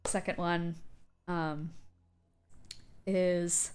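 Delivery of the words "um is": normal talking voice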